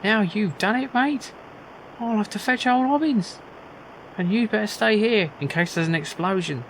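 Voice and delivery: a startled workman telling him off; game character warning of an explosion, high and loud. Acted. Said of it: "I'm giving everyone the same voice".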